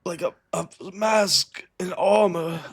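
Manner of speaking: high, weak voice of a dying man; gasping out what he saw; wounded dying man. Acted.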